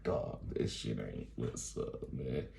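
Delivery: low, soft, slow deflated aside; mock dismay. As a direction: sink down low and quiet, unimpressed. Retold dismay at bouncing bus.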